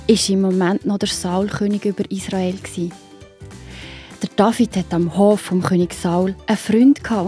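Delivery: ordinary delivery, nothing marked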